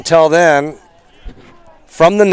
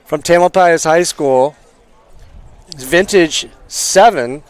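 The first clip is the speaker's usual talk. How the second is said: sports announcer; 5 semitones higher, 3 dB louder than usual. Acted. Wraps up the game broadcast.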